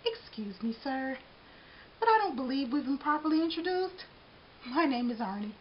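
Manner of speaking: sweeten every word. Acted. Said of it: "said in his sweetest voice".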